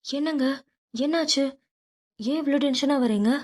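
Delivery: a flustered wife running in to ask her husband why he is upset; flustered mother. A narrator's reading. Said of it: "பதறி அடித்து ஓடி வந்தார்".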